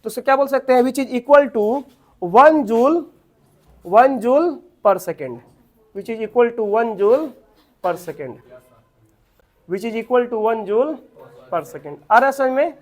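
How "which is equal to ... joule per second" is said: slow, chunked note-giving; slow dictation. Real, not acted. Teacher dictating one joule per second to students, slow dictation. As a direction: dictate slowly, pausing between chunks.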